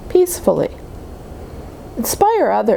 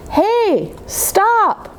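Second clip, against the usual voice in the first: higher, sharp call to stop; 10 semitones higher than usual. Acted. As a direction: cry out to stop a bully. Child stopping someone being hurt.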